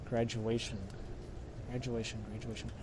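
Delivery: very soft, low repetition; quietly puzzling over a word; musing to oneself. Real, not acted. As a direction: think aloud under your breath. Repeating the clue to oneself, puzzling.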